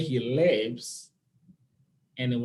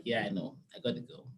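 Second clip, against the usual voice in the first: low, soft, fading to a whisper; resigned reply; 7 semitones lower, 8 dB quieter, faster than usual. Retold or imitated. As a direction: give in with a sigh, almost whispered. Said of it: "So I was like".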